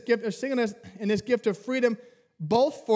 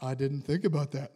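sheepish mumble; 8 semitones lower, 6 dB quieter than usual. Retold or imitated. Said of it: "And I was like".

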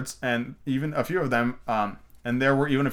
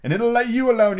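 high, loud puffing frog voice; breathless old frog warning; panting frog; 10 semitones higher, 6 dB louder than usual. A narrator's reading. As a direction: frog warning Alice as he hobbles off. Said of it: "he panted".

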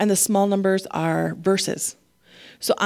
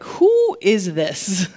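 amazed; 3 semitones lower, much slower than usual. Pretend. People amazed at God's work.

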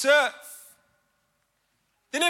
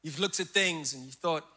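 low, soft and intimate, lower than his preaching; tender pastoral address; 10 semitones lower, 4 dB quieter than usual. Acted. Pastor gently addressing the men in the room, low and soft. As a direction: speak gently to the hurting ones.

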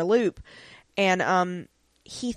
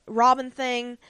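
ordinary talk

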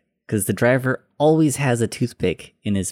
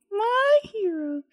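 very high, slow falsetto swoon; mock swoon; slower than usual. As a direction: coo it like a lovestruck fan. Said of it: "echoing the swoon".

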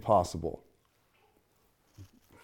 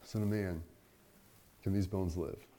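soft, searching question; 6 semitones lower, 10 dB quieter than usual. Acted. Question repeated to congregation.

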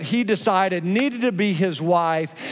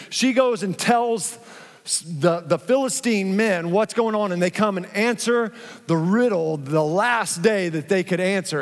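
usual speaking voice

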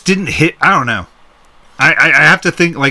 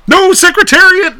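mock despairing yell; 6 dB louder than usual. Answering what else to yell there, mock yell. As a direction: wail it out in mock horror. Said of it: "What else would you yell there?".

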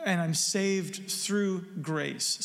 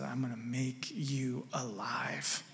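slow, hushed whisper of a promise; 6 semitones lower, 8 dB quieter, slower than usual. Retold or imitated. Pastor voicing Jesus to a dead sinner in a whisper. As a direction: breathe the words slowly, barely voiced.

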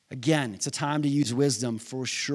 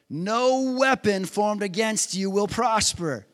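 proclaiming; 4 semitones higher than usual. Real, not acted. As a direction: declare it slowly with weight. Preacher proclaims verse.

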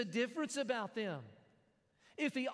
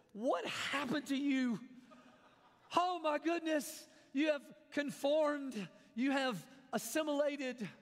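slow, higher-pitched mock horror; drawn-out, exaggerated shock; mock dismay; 4 semitones higher than usual. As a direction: act appalled at a friend's betrayal. Mock dismay at friend's lost accent.

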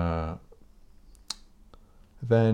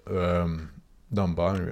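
usual speaking voice

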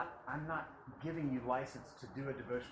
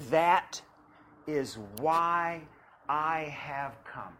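preaching at full force; 3 semitones higher, 13 dB louder than usual. Acted. Reads scripture, preaching loud.

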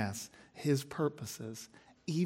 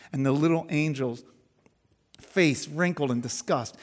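lively, louder storytelling read; story narration; 4 semitones higher, 9 dB louder than usual. Acted. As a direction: read it like a tale to the congregation. Reads J B Phillips story aloud.

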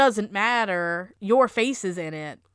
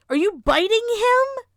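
high, fast disbelief; incredulous; 11 semitones higher, 5 dB louder than usual. Real, not acted. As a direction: react in shocked disbelief. Incredulous outburst at the scene.

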